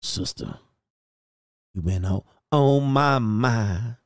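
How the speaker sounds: low, slow seductive pickup line; hint of a lounge crooner; smooth flirt. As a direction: croon it to her like a smooth talker. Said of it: "I'd be like".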